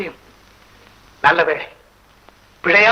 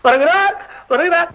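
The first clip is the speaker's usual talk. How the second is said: calling out; 9 semitones higher than usual. Acted. Announcing minister's arrival.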